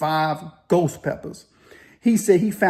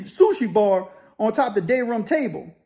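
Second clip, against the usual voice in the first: normal talking voice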